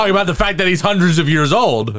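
retold outburst. Co-host replays his own confused outburst, high and loud. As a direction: blurt it out in disbelief. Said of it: "Okay, I was like".

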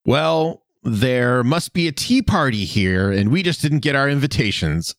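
exaggerated sarcasm. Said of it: "sarcastically adds in an overly exaggerated, sarcastic tone".

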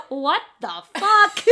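mock outrage. Retold reaction to gossip.

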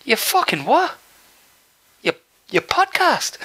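high puzzled Irish voice; Irish accent. Retold or imitated. Irish fan puzzled by the podcast, high Irish accent. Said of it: "He goes".

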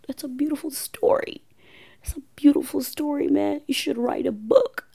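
high, lightly voiced husband, touched; a moved husband praising, voice lifted. Husband moved by wife's life story, lightly voiced. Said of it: "He's like".

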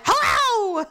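irritated snap back. Retold or imitated. Hurt woman answering a greeting, high irritated yell. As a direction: throw the greeting back in pain. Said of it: "I'm in pain".

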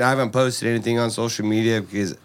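ordinary talk

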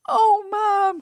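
put-on high, loud wail of a little child; upset toddler. Toddler after wetting herself, high upset wail. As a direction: cry out like a wet, upset toddler. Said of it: "you go".

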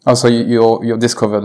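ordinary delivery

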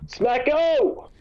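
high, drawn-out playful whoop; hyped-up name call. Pretend. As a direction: holler the nickname like a hype man. Gleefully calling out the emailer's name.

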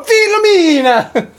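high, drawn-out mock Italian cry; very high, stretched Italian-accent holler, played for laughs; exaggerated Italian accent. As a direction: call the name out in hammed-up Italian. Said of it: "No one's walking around going".

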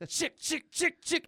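high, quick claw-slashing sounds; mouth-made slicing noises; playful claw noises. Acted. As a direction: mimic blades popping out. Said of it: "he's making the sounds".

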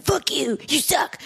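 high, hammed-up yelling insults; mock angry commenter. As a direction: play a ranting internet troll. Angry online commenters quoted.

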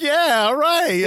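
high, drawn-out gloating cheer; proud cheer. Retold or imitated. Retelling the bully pushing him off, high proud cheer. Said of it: "he was so proud".

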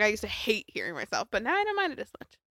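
usual speaking voice

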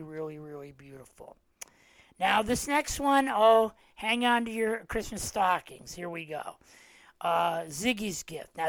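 normal talking voice

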